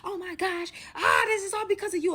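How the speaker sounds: high-pitched, rushed panicky blaming; freaking out. Retold or imitated. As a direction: imitate a frantic man spiralling into accusation. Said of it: "and he's freaking out".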